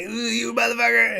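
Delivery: high, strained mock fury; mock outrage. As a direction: squeeze it out high like a man enraged. Man enraged at dog's killer.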